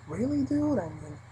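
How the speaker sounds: soft incredulous muttering to yourself; hushed sarcastic inner voice; inner disbelief. Retold or imitated. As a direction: think it in quiet disbelief. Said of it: "I said this all inside".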